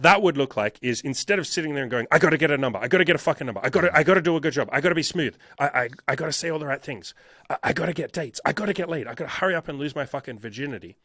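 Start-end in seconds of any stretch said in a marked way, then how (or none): 2.1-11.0 frantic, tumbling mock inner monologue; anxious self-pressure. Acts out anxious dater's inner panic.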